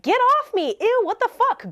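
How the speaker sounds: high-pitched, disgusted mock protest; lightly acted repelled outburst; imagined indignant rebuff. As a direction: act out pushing someone away in outrage. Imagined assertive person fending him off.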